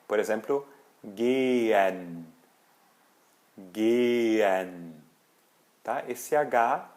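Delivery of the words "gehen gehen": gehen with the middle H left silent. Shown as a demonstration. Teacher showing students the silent H. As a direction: say gehen without the H. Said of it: "a gente não pronuncia".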